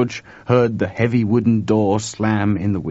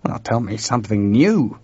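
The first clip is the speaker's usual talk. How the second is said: plain reading voice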